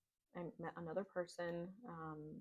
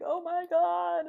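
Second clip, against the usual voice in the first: much higher and louder, drawn-out "Oh my God"; amazed gasp; 12 semitones higher, 13 dB louder, much slower than usual. Retold or imitated. Telling viewers how the changes stunned her, in slow high awe. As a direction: stretch it out in wide-eyed wonder.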